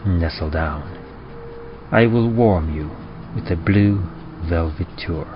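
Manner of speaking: ordinary talk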